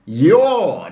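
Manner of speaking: "your" stretched out long with a falling tune; single emphatic "your", held and driven home; stressed YOUR. Shown as a demonstration. Coach singling out the word for viewers, heavy stress on "your". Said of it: "I keep stressing that word".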